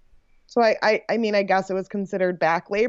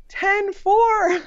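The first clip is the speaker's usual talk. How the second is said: high-pitched call, only a little louder; imitated nurse's shout; 12 semitones higher, 3 dB louder than usual. Nurse announcing the birth weight to the room, mock shout. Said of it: "I hear the nurse shout".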